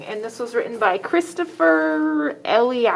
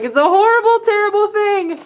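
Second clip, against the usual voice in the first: dramatic dread; 8 semitones higher than usual. Acted. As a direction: ham up the horror for the kids. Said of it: "He's like".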